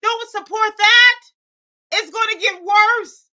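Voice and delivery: high, loud outcry; frantic plea. Real, not acted. Streamer pleading with the chat, high loud outcry. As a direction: cry out in mock despair. Begging chat not to encourage.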